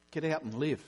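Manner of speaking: usual speaking voice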